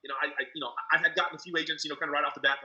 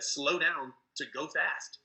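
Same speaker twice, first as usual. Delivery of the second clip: slowed right down, each word spaced out; slow advice; much slower than usual. Retold or imitated. Mentor telling an over-eager recruiter to slow down, slow and deliberate. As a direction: say it slowly, the way you preach it. Gene's advice, talk less.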